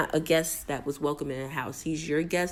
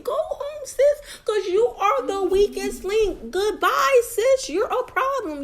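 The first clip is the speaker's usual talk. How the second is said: much higher, louder sing-song taunt; mocking high voice; 12 semitones higher, 4 dB louder than usual. Recapper voices the houseguest's exit, then mocks her, much higher sing-song. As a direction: taunt her off the show in a squeaky voice. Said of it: "you're talking about".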